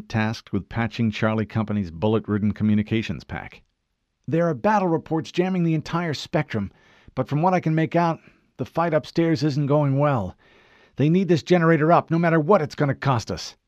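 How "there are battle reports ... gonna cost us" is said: higher, tense soldier's report; Kelly reporting to Fred, tense and urgent. Acted. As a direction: push it out under pressure. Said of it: "tension thickening her voice".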